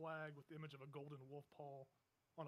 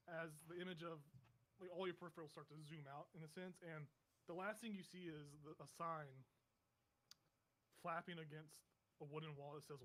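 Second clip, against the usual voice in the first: usual speaking voice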